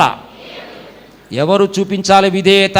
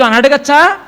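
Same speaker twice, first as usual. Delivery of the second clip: ordinary talk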